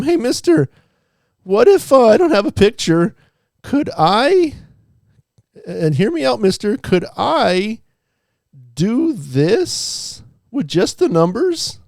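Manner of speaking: teacher voicing a made-up student asking a question, high and eager; imagined student voice. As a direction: play a squeaky pleading student. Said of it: "how I imagine they sound".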